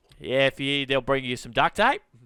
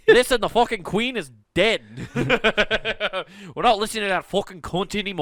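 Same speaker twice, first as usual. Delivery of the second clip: put-on rowdy Irish drunk; 4 semitones higher than usual. Drunk Irish pub rant.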